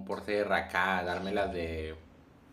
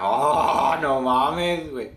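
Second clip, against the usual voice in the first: surprised shout; 5 semitones higher, 11 dB louder, much slower than usual. Real, not acted. Reaction in match.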